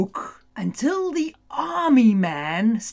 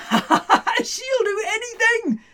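laughing; 11 semitones higher than usual. Acted. Jemima grinning.

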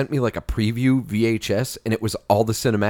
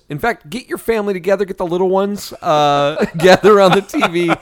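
higher, louder announcer pitch for a holiday special; mock TV promo; 6 semitones higher, 5 dB louder than usual. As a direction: sell it like a cheesy holiday ad.

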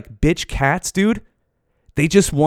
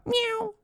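tiny squeaky meow; cat meowing after defending humans; high meow; 9 dB quieter than usual. Acted. Cat voice ends quote.

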